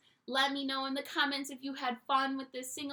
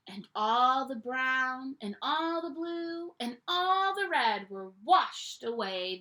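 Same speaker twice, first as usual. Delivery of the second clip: ordinary delivery, nothing marked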